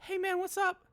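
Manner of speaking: very high, squeaky imitation of a colleague; imitating co-worker's voice. Retelling a co-worker's passing greeting, imitated high voice. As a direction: mimic a guy with an oddly high voice. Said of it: "he just talks like that".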